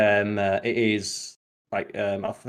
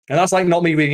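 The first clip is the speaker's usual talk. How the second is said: emphatic; 6 semitones higher, 7 dB louder, faster than usual. Real, not acted. Emphatic fast insistence.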